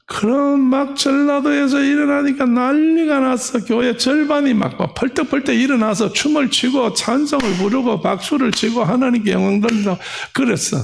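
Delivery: high, rushing, excited account; excited storytelling. Real, not acted. Preacher describes a Jeolla church erupting, high and excited. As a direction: tell it as one breathless pile-up of jubilation.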